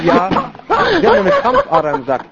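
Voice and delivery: doctor's line squeaked high with a laugh; high mock doctor voice. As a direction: repeat the diagnosis in a silly high voice. Zweite Version, hoch. (Diagnose in hoher, lachender Stimme wiederholt)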